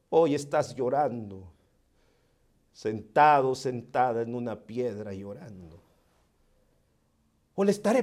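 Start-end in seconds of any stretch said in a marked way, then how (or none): 0.1-5.8 soft, low, intimate preaching; hushed sermon. Acted. Preacher comforting the grieving listener, softly. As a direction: lean in and speak softly to the hurting.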